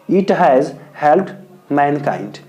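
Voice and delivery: slow, word by word dictation; teacher dictating the model answer to students; dictation pace. Shown as a demonstration. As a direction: spell it out slowly for the notebook. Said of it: "तो लिखेंगे".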